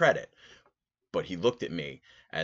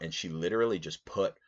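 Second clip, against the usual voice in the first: ordinary talk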